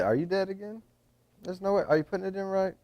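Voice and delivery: surprised. Real, not acted. Scolding the dead battery.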